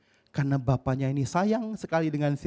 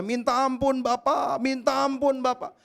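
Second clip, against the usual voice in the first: put-on high, slow, pleading child; begging; 8 semitones higher, slower than usual. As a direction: beg for mercy, drawn out. Son begging father.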